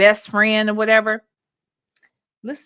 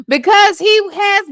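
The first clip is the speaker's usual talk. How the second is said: excited outburst; 9 semitones higher, 5 dB louder than usual. Real, not acted.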